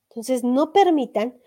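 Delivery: usual speaking voice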